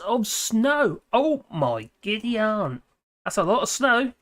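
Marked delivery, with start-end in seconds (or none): 1.1-2.8 slow, drawn-out "Oh my giddy aunt"; mock astonishment. Real, not acted. Streamer reacting to a viewer's six inches of snow, slowly amazed.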